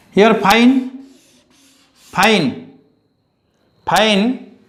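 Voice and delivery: fine repeated, each one pointed at; teacher drilling fine; stressing fine. Shown as a demonstration. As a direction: tap the word fine three times for the class. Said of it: "we want to give the emphasis".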